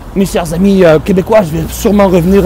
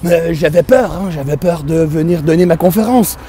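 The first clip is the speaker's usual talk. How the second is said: high, bright mock-Parisian put-on voice; hammed-up Quebecer gone Parisian; 4 semitones higher than usual. A Quebec comedian mocking Paris airs, hammed-up. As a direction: play a Quebecer faking Paris chic.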